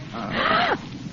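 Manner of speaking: high, soft gasp of surprise; delighted gasp. Acted. Wife opening her birthday box, astonished gasp. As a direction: gasp at an unexpected gift.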